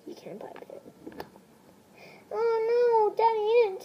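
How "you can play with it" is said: whisper. Acted. Daddy answers under his breath.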